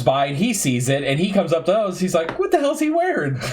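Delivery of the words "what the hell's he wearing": much higher, disbelieving outburst from the boss; incredulous boss. Retold or imitated. Boss spotting the knee pads, higher incredulous outburst. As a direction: imitate the boss's shocked double take.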